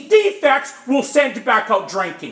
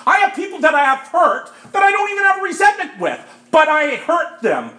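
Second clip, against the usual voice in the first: usual speaking voice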